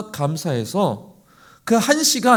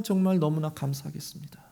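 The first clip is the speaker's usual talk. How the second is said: soft breathy whisper; whispered thanks; 4 semitones lower, 7 dB quieter than usual. Pretend. Believer thanking the Lord, whispered. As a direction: pray it under your breath.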